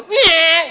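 very loud, high "nyah" whine; mock baby wail. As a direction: blurt out a baby's giving-up cry. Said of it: "When they sit down and go".

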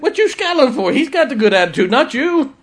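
high, loud mock scold from a little old lady; sassy scolding. The lady scolding her scowling friend in the hallway, loud and high. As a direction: bark it out like a feisty church lady.

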